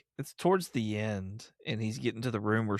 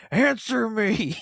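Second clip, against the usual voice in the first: slow, higher, louder mock-threatening order; menacing demand; 10 semitones higher, 5 dB louder, slower than usual. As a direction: bark it out like a cartoonishly stern interrogator. Hero's menacing demand quoted.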